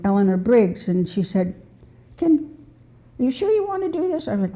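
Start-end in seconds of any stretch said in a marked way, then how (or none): 2.2-4.3 much higher, fast, quoting a doubtful friend; mock-worried question from a friend. Friend asks if she's sure.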